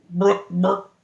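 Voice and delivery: a mock fart sound shaped into a name; fart-like word. Man talking out his ass to win a bet, fart-like voice. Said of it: "that noise came out of his ass".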